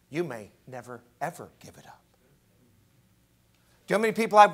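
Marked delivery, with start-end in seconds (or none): 0.1-2.0 soft, grave whisper; warning whisper. Acted. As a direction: breathe the warning quietly.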